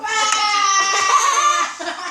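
wailing laughter. Real, not acted. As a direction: laugh and wail at once. Teammate falls.

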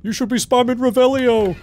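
high, loud mocking viewer shout; mock viewer yell. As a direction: yell it like an annoyed chat. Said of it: "people are screaming at me".

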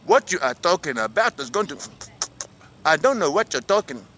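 put-on African accent; audition-style African accent. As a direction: answer in an attempted African accent. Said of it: "Can you do an African accent?".

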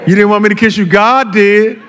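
high, loud comic protest; mock-indignant pulpit punchline; joking excuse. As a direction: exclaim it high and loud, playing for laughs. Joking imagined excuse for kissing.

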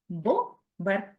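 usual speaking voice

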